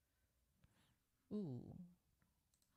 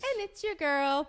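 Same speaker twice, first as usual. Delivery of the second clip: show host intro; 6 semitones higher, 5 dB louder than usual. Acted.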